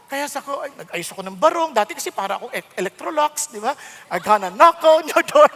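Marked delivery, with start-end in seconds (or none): 4.1-5.5 loud salesman pitch. Retold or imitated. Pastor mocking his old look, loud salesman pitch. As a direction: play a pushy door-to-door salesman. Imitating door-to-door Electrolux salesman.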